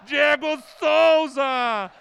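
long screamed goal call; explosive goal commentary. Real, not acted. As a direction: scream the goal with all you have.

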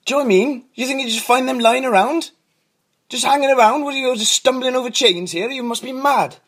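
high-pitched, affronted rant; indignant comeback. Acted. Cobbler defends his effort to unhappy customer, indignant and high. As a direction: argue back, pitched right up.

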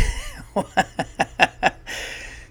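breathy burst of real laughter; real wheezy laughing fit; helpless laughter. Real, not acted. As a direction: crack up mid-sentence. Cracks up starting his story.

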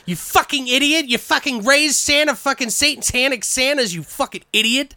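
high, mock-furious yelling; angry Helen. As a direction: tear into him for raising Santas. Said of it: "She's like".

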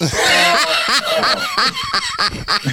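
high, long burst of laughter. Real, not acted. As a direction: crack up laughing. Laughing at the trash-rap joke.

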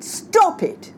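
shouted. A narrator's reading. Mother repeats herself to bickering children, shouting. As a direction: raise the voice hard to cut them off. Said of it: "Rose shouted".

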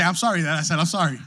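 fast, laughing through the words; sheepish laughing apology to his wife. Retold or imitated. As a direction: blurt it out with a laugh. Retold joke to wife.

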